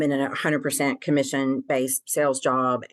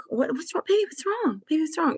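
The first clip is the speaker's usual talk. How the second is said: high-pitched, cooing mock concern; imitated concerned husband; 12 semitones higher than usual. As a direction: mimic a partner fussing, voice way up high. Mimics husband asking what's wrong.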